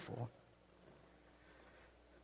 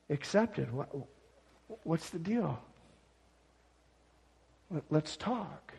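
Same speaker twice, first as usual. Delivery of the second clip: gentle and calm. Acted. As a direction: ask it gently, like a patient father. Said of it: "God gently and calmly says".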